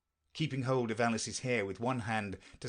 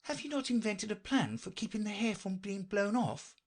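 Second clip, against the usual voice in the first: high-pitched, polite inquirer; Alice; 11 semitones higher than usual. Acted. Alice inquires after the knight's plan, high and polite. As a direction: ask politely in a raised voice.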